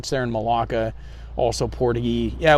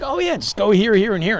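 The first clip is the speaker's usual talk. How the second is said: bright, much higher and louder eager helper; cheerful helpful clerk; 9 semitones higher, 5 dB louder than usual. Retold or imitated. Traveler quoting a friendly clerk, high cheerful voice. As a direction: chirp it like an obliging official.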